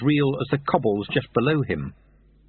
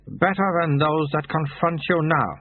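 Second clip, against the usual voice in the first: lightly voiced brisk recruiter, higher; Soto's voice; 6 semitones higher than usual. Recruiter offers young thief a job, lightly voiced brisk monk. Soto continues recruiting pitch.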